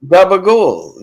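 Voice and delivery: high, loud mock Italian-American; hammed-up Italian-American pronunciation. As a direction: repeat it emphatically in imitation Italian-American. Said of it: "they say gabagool".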